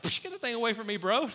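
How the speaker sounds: loud, high, scoffing brush-off; mock dismissive shove-off. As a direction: wave it away like a bored bro. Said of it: "So he's not like".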